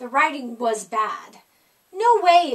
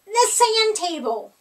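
higher, louder eager cry; excited; 6 semitones higher, 5 dB louder than usual. A narrator's reading. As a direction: realize and shout it. Kids shouting answer.

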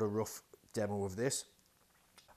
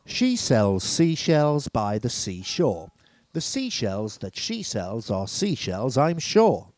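slow and much louder, hitting every s; loud test read; 14 dB louder, slower than usual. Shown as a demonstration. Testing the mic without the de-esser, loud and slow. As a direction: belt the tongue twister out for the mic. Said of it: "That's undiest".

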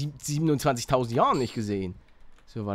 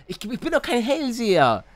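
ordinary talk

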